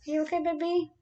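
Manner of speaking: high, soft cooing question. Real, not acted. Checking on her baby.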